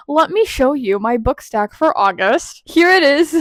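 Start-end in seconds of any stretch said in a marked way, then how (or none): 2.6-3.3 high, loud excited ta-da; excited reveal. Real, not acted. Vlogger showing viewers her book stack, excited. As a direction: present it with a proud, excited burst.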